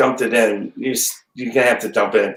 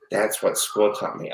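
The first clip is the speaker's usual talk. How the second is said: ordinary delivery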